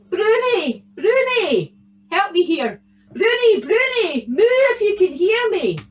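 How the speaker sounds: high, loud swooping call; shouting for Bruni. A narrator's reading. Tam searches for his stolen cow, shouting. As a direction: holler for a lost cow. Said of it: "he shouted".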